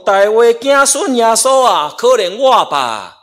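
loud, high call for mercy; shouted plea, slowing at the end; crying out. Retold or imitated. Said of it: "就喊着讲".

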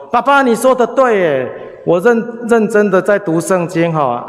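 模仿孩子蹦跳出来时的雀跃，音调高很多、声音更大; 学小孩兴奋地报喜; imitating happy child. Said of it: "很高兴". (模仿儿子高兴地对爸爸说，音调明显提高)

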